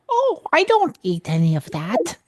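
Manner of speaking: high, slow silly puppet voice. Retold or imitated. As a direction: play a fussy little puppet. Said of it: "does your puppet ever go".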